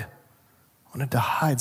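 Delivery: hushed and slow; Adam admits he hid, softly and slowly. Acted. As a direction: let it sink in quietly. Adam's answer repeated.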